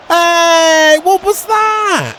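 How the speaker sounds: sudden high yell at the game; shouting in disbelief at the screen. Real, not acted. Gamer yelling at match event.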